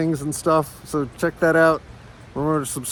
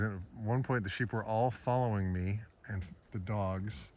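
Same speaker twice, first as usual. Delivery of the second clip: hushed recap; 10 dB quieter than usual. Real, not acted. Quiet recap after filming.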